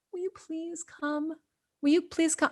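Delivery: tiny, high, very soft mock plea; timid pleading. Shown as a demonstration. Showing how she didn't ask dolphins, mock timid. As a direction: beg meekly, barely audible. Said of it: "I didn't ask this way".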